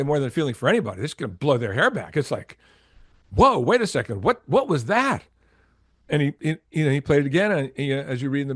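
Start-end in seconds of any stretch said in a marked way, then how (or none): 3.3-5.2 stunned double take, pitch leaping on the end; amazed reaction to a first listen; retold astonishment.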